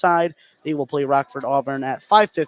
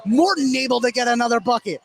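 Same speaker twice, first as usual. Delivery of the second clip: excited call; 8 semitones higher than usual. Real, not acted. Morton drives for a layup.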